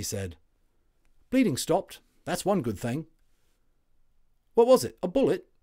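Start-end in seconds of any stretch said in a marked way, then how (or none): 1.3-5.5 much higher, louder chirpy soldier voice; a WWI orderly waking up chatty; friendly Cockney Tommy, loud and high; cheery Cockney medic. Acted.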